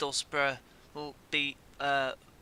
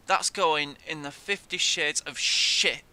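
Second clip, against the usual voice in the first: louder, slow indignant pronouncement; slow indignant declaration; 7 dB louder than usual. Real, not acted. Gamer declaring the goal goes in his rant book, slow loud indignation.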